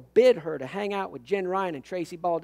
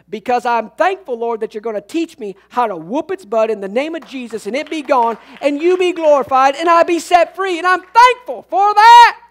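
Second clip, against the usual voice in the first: starts firm, climbs higher and louder to a shouted, slowed last line; building preaching; 6 semitones higher, 10 dB louder than usual. Acted. Preacher voicing a bold thankful prayer to the congregation, building to a shout. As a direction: build the prayer up to a ringing peak.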